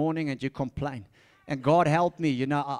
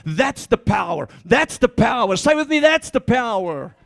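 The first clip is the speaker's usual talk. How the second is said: loud, ringing pulpit proclamation; preaching at full force; 2 semitones higher, 6 dB louder than usual. Acted. Preacher to the church, loud and fervent. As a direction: drive it home to the congregation.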